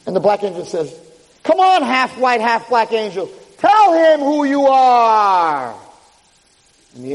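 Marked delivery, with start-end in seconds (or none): 1.4-5.7 high call ending in a long drawn-out cry; stretched-out high villain command. Retold or imitated. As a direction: goad the angel to confess.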